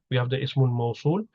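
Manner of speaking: ordinary delivery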